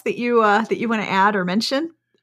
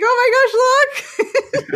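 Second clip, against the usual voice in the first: excited squeal; 14 semitones higher, 4 dB louder, much slower than usual. Retold or imitated.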